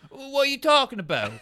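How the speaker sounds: high, loud confused squeal; the panicked radio man, high and loud; mock panicky crewman.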